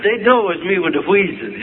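normal talking voice

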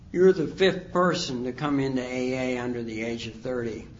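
ordinary talk

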